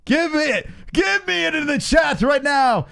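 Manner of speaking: high, slow drawn-out begging; hammed-up pleading. Real, not acted. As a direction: beg the chat for it. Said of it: "I need to take this public humiliation".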